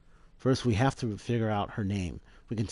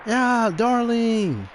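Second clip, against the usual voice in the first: slow, much higher and louder mock cry; hammed-up cheer; 9 dB louder, much slower than usual. Mocking the dead professor's farewell, loud and hammy. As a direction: belt it out like a silly cartoon.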